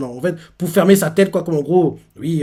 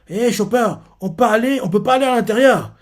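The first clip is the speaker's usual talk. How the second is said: high, eager call to his friend; Luffy calling out; 8 semitones higher, 3 dB louder than usual. Retold or imitated. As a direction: call up to Chopper, keyed up and high. Luffy calls to Chopper. (Luffy qui appelle, voix aiguë)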